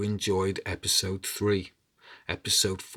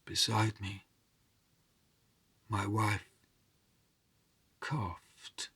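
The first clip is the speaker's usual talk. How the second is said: measured storyteller voice, dramatic rises and hushes; story narration. A narrator's reading. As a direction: tell the tale to a listening crowd. Storyteller narrating a folk tale.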